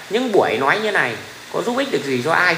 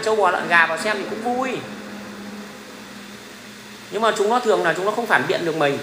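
ordinary talk, nothing marked

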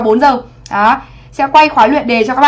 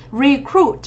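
"Recruit" stressed on the second syllable, cruit; teacher modelling re-CRUIT. Shown as a demonstration. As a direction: put the weight on the end of "Recruit". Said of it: "Trọng âm hai".

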